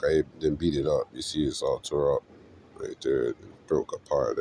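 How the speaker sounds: usual speaking voice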